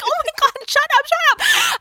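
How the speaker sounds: high, urgent mock panic; mock panicked hushing. Mom mortified as daughter announces fig picking, mock panic.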